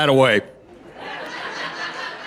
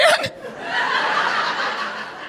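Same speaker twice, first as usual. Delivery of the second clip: airy laugh rising high; high breathy laugh; 6 dB quieter than usual. Real, not acted. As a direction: let out a breathy giggle. Laughing at the idea.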